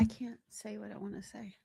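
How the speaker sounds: hushed whisper, holding back. Real, not acted. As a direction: whisper it, biting your tongue.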